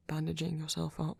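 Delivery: ordinary talk, nothing marked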